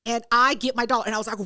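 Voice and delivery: usual speaking voice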